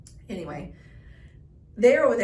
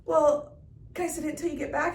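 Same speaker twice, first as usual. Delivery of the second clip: high, quick imitation of the kid; cute kid; 7 semitones higher, faster than usual. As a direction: rush the kid's plea, pitched up. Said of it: "And he goes".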